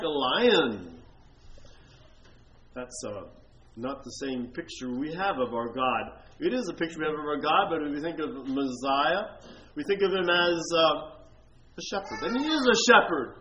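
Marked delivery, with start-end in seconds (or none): none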